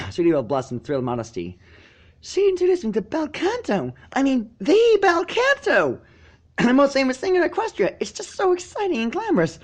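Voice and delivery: put-on excited high young filly gushing. Sweetie Belle telling Spike about her lessons, high and gushing. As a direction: bubble over about singing lessons.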